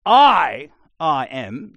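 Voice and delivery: "I" punched very loud before "I am"; emphatic I. Shown as a demonstration. Preacher shows the emphatic form, loud stress on I. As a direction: hit "I" hard. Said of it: "If you want to say".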